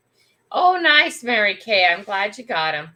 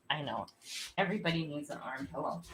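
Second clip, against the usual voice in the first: ordinary talk, nothing marked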